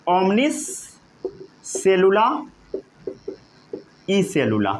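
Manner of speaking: Latin phrase word by word; spaced-out, measured Latin; slow dictation. Shown as a demonstration. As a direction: dictate with a pause after each word.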